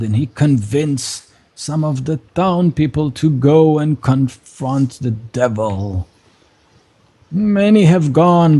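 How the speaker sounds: high, slow lament for a lost son; grieving priest. Acted. Priest telling the strangers about his undead son. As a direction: mourn the son you locked away.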